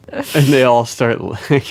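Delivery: normal talking voice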